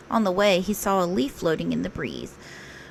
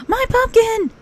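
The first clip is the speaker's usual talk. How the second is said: much higher, louder alarmed cry; 5 dB louder than usual. Acted. Rabbit crying out as his pumpkin rolls off. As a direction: yelp as your pumpkin rolls away. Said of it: "cried Rabbit".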